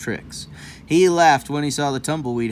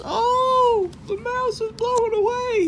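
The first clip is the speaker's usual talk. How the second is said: 1 surprised. Real, not acted. Surprised at the picture.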